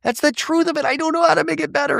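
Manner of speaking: high-pitched imitation of someone welling up; retold on the verge of tears; mock tearful. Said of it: "And she starts tearing up".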